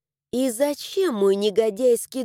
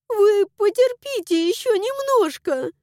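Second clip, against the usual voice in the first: put-on tearful girl voice; 10 semitones higher, 4 dB louder than usual. A narrator's reading. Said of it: "схлипнула за окном Флэша".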